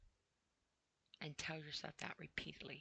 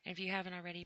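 ordinary delivery

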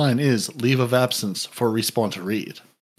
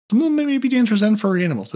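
excited; 10 semitones higher, faster than usual. Acted. Comic build-up list.

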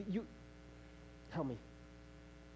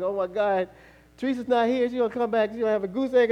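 loud, raised fretting; mock panic; 4 semitones higher, 10 dB louder than usual. Father dreading his wife's return, mock-panicked. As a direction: fret aloud in comic dread.

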